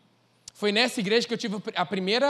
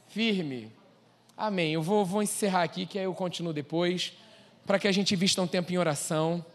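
usual speaking voice

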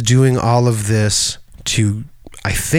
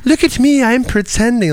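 much higher, hammy boasting; mock showing off; 13 semitones higher than usual. Characters mocking acting, high and hammy. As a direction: ham it up in a high voice. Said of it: "they made fun of that".

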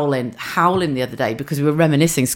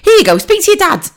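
much higher voice, briskly passing the phone; flustered mum; 6 dB louder than usual. Retold or imitated. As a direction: hand the phone off to get out of it. Mum handing phone over.

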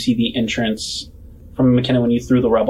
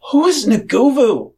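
high-pitched, louder character voice; Sisa; 12 semitones higher, 5 dB louder than usual. Acted. Sisa questioning the party, high put-on voice. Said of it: "Sisa looks around at all of you and he says".

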